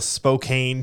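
Spokane as spo-CANE, with a long a; mock mispronunciation. Cohost teasing the host's misreading, Spokane as spo-CANE. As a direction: echo Spokane wrongly, rhyming with cane. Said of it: "It's spelt Spokane".

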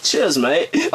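put-on terrible British accent. Mimicking a kid at the gym, terrible British accent. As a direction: badly fake a British accent to tease. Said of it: "I was terrible accent".

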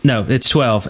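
normal talking voice

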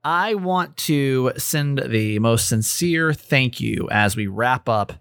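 1 podcast outro. Acted. Host outro over music.

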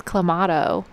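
"clamato" with AH in the middle, cla-MAH-to; corrected pronunciation. Shown as a demonstration. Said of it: "I think I have to correct you".

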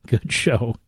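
a real chuckle breaking through "very good show"; laughing. Real, not acted. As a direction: laugh it out mid-phrase. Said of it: "Why am I laughing?".

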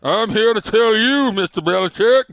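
high, loud, slow pompous tycoon; Bob Kraft snooty rich guy voice. Acted. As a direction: puff up like a snooty rich owner. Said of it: "that's my snooty rich guy voice".

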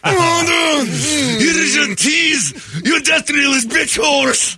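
very high, slow shrieking worker voice; shouting cashier. Acted. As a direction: shriek the insult out slowly.

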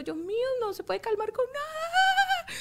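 drawn-out high-pitched mock wail; desperate venting cry. Mother re-enacting her need to vent, desperate wailing. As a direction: let desperation climb into a stretched wail. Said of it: "Necesito como gritar, de-, drenar".

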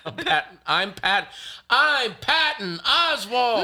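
laughing, high, shouted self-introduction; game show contestant. Pretend. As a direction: yell your name like a contestant. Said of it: "say who you are".

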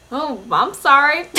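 sorry said through a laugh; laughing apology. Retold or imitated. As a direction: laughs while apologising awkwardly. Retold awkward apology to cook.

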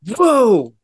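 sudden high startled whoa, retold. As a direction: jump up high on the whoa as if shocked all over again. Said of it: "it just shocked me".

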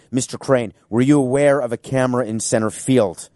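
ordinary delivery

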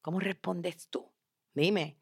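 very soft, intimate prompt; quiet, searching appeal; hushed question. Real, not acted. Softly asks listener to reflect.

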